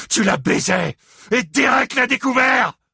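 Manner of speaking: high, loud furious bellow. A narrator's reading. Father bellows at Gérald about the affair, enraged. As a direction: roar the accusation. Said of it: "continua à beugler".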